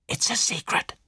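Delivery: hushed, confiding whisper; secretive whisper. Acted. Ginevra confides a secret to detective, whispering.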